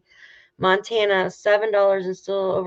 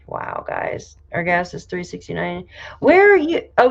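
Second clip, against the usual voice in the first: ordinary delivery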